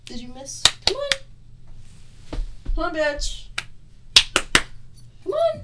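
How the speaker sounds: high-pitched, coaxing pet call; high, eager dog-calling voice. Real, not acted. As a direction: coax a dog over in a high voice.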